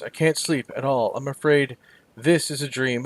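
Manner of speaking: ordinary talk, nothing marked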